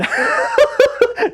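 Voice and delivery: high, loud dotty giggle; squeaky mad tea-party laugh; Hatter giggle. Acted. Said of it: "Mad Hatter voice".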